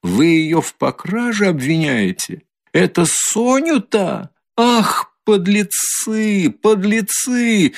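high, slow, outraged accusation; outraged woman. A narrator's reading. Katerina, defending Sonya from Luzhin, high and outraged. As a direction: spit out the insults, appalled.

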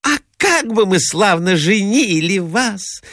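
high, lilting female character voice; eager lady chiming in. A narrator's reading. Second lady offers to marry off the prince, high and eager. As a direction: chime in high as an enthusiastic lady.

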